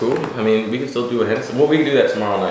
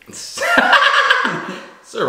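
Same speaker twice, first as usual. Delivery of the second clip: laughing shout. Real, not acted. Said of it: "plugging his handle, laughing".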